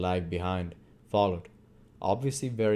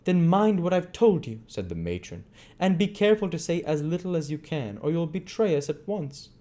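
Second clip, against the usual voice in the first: higher, firm warning voice; stern matron; 9 semitones higher, 4 dB louder than usual. A narrator's reading. Matron warning Bumble to say little, higher firm voice. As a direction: warn him like a sharp matron.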